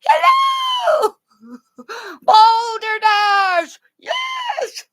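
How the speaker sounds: shrieked manic high-pitched impression; shrieking Robin Williams bit. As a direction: yell it out like a manic comedian. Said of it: "Robin Williams enters my body".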